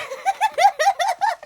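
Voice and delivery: loud high shrieking laugh. Real, not acted. Laughing at the missed shot.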